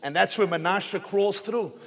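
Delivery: ordinary delivery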